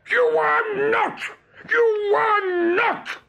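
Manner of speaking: high, drawn-out hoarse shout; hoarse cry. A narrator's reading. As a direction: shout a strained, furious denial. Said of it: "cried Hook hoarsely".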